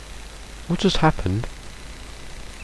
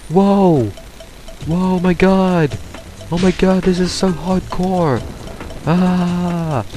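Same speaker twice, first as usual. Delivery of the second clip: thrilled shrieks; 9 semitones higher, slower than usual. Real, not acted. Riding the shell too fast.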